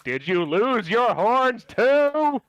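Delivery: a player shaking the man and shouting. Acted. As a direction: yell it right in his face while shaking him. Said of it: "It's gonna get aggressively louder".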